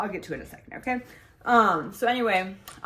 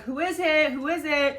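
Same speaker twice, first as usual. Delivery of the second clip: raised, higher call through a closed door; 7 semitones higher, 3 dB louder than usual. Retold or imitated. Young mother calling to late knocking at her door, raised voice. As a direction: call out who is it, a little alarmed.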